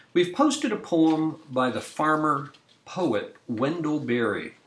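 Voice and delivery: usual speaking voice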